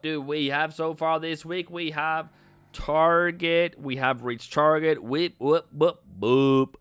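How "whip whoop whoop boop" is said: louder playful whoops; celebration noises. Pretend. Cheering the chat after the target is hit, playful whoops. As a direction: bounce the sounds like a victory jingle.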